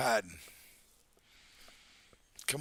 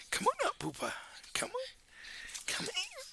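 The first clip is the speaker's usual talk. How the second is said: very high sing-song dog calling; squeaky pet calls; 6 dB quieter than usual. Real, not acted. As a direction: coax the pup up in a squeaky voice.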